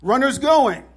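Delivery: loud, high call across the field; a sharp warning yell from the dugout; teammate yelling. Retold or imitated. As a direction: shout it to warn the whole team. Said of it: "I can hear Carlos yell".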